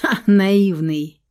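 ordinary delivery, nothing marked

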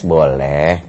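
low, drawn-out indulgent father; permissive father. Acted. Father lets child play games, low easygoing voice. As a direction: drop the voice for an easygoing Dad.

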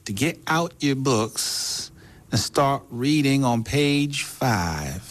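slow, high Texan schoolteacher drawl; drawling Texas schoolmarm addressing the class; Texas drawl teacher. Retold or imitated. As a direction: stretch every word like a Texan teacher. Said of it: "In Texas praten ze heel langzaam".

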